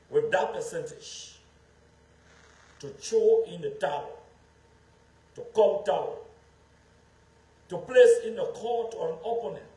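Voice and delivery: rally the crowd with rising passion; impassioned oratory. Real, not acted. Speech rises to impassioned oratory.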